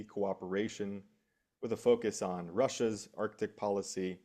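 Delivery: normal talking voice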